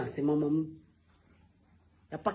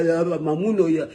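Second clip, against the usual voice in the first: ordinary delivery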